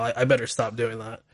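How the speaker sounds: normal talking voice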